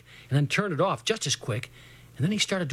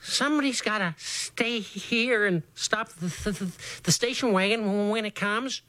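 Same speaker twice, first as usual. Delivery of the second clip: high, slow and frightened; pleading with chattering fear; scared boy; 5 semitones higher than usual. A narrator's reading.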